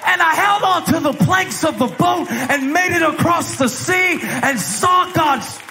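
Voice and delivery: impassioned preaching. Acted. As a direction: preach it out as triumphant testimony. Preacher testifying at high intensity.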